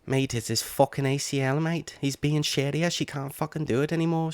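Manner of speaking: high-pitched mock Scouse voice; hammed-up Scouse excuse-making, pitched high; Darren Till's Scouse accent. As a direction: imitate a Liverpool accent, high and slushy. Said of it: "your accent adds to it".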